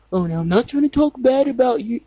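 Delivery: imitating him, pitch lifted; mildly mock his awkward excuse; weird evasive phrasing. Child retelling the father's remark about Mom, weird way. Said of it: "He would say in a weird way like that".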